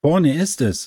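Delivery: high-pitched, mildly mysterious announcement; hint at a secret just ahead. A narrator's reading. Mika reveals the waterfall ahead, with an air of mystery. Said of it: "mit so einer geheimnisvollen Stimme".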